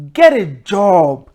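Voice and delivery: loud, slow, scornful get a job; contemptuous scolding; scornful order. Retold or imitated. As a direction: bark it down the line at him. Scolding scammer on the phone.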